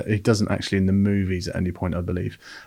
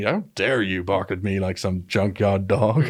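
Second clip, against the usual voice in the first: mock indignant line; slower than usual. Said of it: "I'm gonna start saying that".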